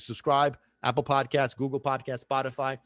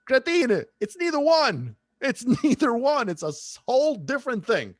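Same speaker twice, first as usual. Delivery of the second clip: louder and higher, half laughing in frustration; exasperated outburst; 10 semitones higher, 5 dB louder than usual. Real, not acted. Host fed up with the sauce-versus-gravy fight, yelling and laughing. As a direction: snap at the arguers with a laughing yell.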